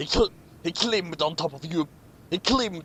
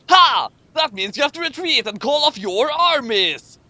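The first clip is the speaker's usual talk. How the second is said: triumphant; 8 semitones higher, 7 dB louder than usual. Acted. Triumphant character quote in story.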